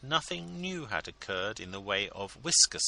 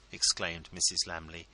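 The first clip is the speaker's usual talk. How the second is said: plain reading voice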